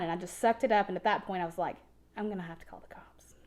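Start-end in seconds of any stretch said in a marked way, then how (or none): 2.2-3.3 a secret thought while he is in the room; whispered thought. Retold or imitated. Woman thinking about calling the cops, whispered. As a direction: whisper it, much softer.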